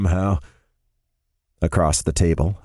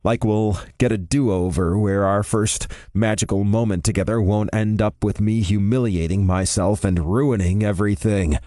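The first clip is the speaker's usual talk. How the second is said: ordinary delivery, nothing marked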